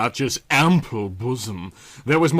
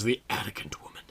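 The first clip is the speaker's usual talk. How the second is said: hissed under the breath; bitter whisper; 3 semitones lower, 10 dB quieter, faster than usual. Acted. Irene whispering scorn at Callie. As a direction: whisper the insult with contempt.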